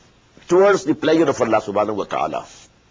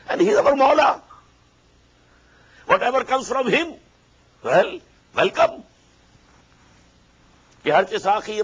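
much higher, ardent preaching; rising fervour; 8 semitones higher, faster than usual. Acted. Preacher urging acceptance of God's will, high fervent tone. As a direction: lift the voice high with conviction.

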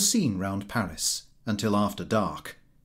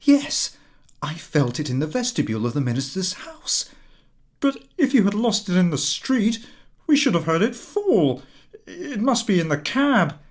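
high, hurried anxious reply; wife reasoning where the necklace fell; 8 semitones higher than usual. A narrator's reading. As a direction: explain quickly, fighting panic. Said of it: "wife answering anxiously".

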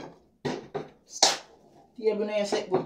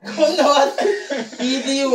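laughing; 6 semitones higher, 7 dB louder than usual. Real, not acted. Excited taunting during game play.

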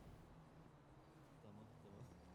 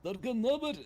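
high mock voice of a guy; imitating a guy; 11 semitones higher than usual. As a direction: mimic the way he talked, higher. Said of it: "öyle konuşuyordu".

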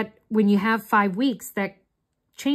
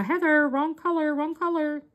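raised-pitch mock scolding of herself; mock viewers scolding; 7 semitones higher than usual. Viewers scold her in a lightly raised mock scolding voice. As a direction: pretend the viewers are scolding her about the colour. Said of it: "Are you all yelling at me and saying".

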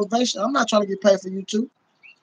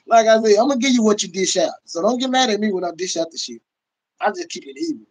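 usual speaking voice

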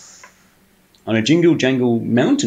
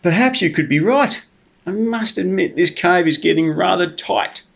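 higher, drowsy bear musing; sleepy bear voice; 7 semitones higher than usual. Acted.

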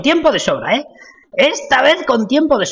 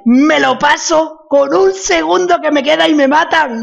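exasperated; 6 semitones higher, 4 dB louder than usual. Real, not acted. Complains after the unfair death.

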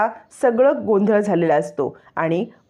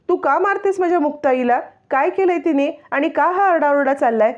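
put-on much higher, indignant little girl; a small child demanding answers; child's voice; 8 semitones higher than usual. Child Sai confronts Indra. (उंच आवाजात लहान मुलीचा जाब)

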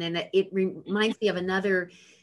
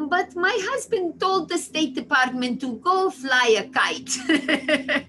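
higher, louder mock of mother breaking into a laugh; imitating mother; 8 semitones higher, 5 dB louder, slower than usual. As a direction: play mum's cheeky line, laughing at the end. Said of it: "as my mother very cutely says".

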